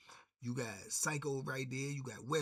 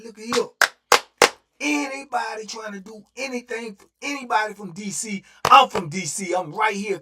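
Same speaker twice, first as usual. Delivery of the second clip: shouted high and loud across the pod; loud challenge; 7 semitones higher, 15 dB louder than usual. Retold or imitated. Standing mid-pod, he shouts a challenge at everyone. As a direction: call out the whole room.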